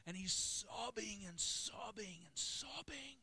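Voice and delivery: very high, slow and airy; emotional, drawn-out near-wail; overwrought retelling. Retold or imitated. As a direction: stretch each sobbing out, voice straining.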